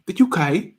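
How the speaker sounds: high British accent slipping into Australian; hammed-up, high-pitched British-Australian accent; attempted UK accent. Shown as a demonstration. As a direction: put on a bad British accent. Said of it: "No, that's Australia".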